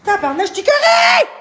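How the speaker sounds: high, strained shouted curse; sudden high furious yell. Real, not acted. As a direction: explode at the chat. Erupts after a low curse.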